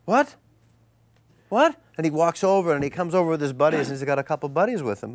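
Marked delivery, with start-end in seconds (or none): 0.1-1.8 high, baffled double take; high, puzzled reply to a stranger shouting at you; confused what. Retold or imitated. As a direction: toss it back high, bewildered. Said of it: "And I went".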